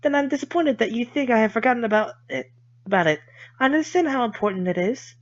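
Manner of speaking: put-on politely wounded girl; Lily voice. Voicing Lily, offended.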